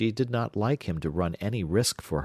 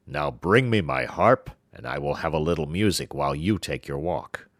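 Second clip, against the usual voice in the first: giant; 4 semitones lower than usual. Acted. Giant orders his wife to bring the harp. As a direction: lower giant's command. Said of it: "he said".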